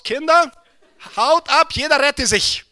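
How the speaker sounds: high, loud mock warning cry; hammed-up alarm call to the children; panicked shout. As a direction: yell it like kids scattering in panic. Children fleeing a stressed father.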